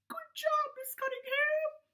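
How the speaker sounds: high puppet voice praising the teacher; Gilbert puppet. Acted. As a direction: pipe it up like a toy shark.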